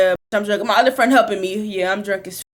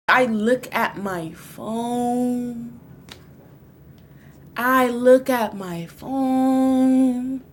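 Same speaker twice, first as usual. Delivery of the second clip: dread; much slower than usual. Acted. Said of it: "slow dramatic realization".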